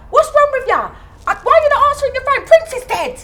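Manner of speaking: high, fast imitation of a friend; mock the friend's blunt delivery. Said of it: "He said it just like that".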